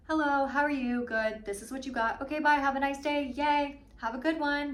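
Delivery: polite cashier. Retold or imitated. Imitating the polite girls at checkout, bright and quick. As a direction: breeze through it like a cheerful cashier. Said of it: "very polite, standard".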